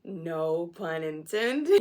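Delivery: loud, very slow, rising at the end; big drawn-out comic delivery; hammed-up punchline. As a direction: stretch it out and milk the joke. Repeats the pun, hammed up.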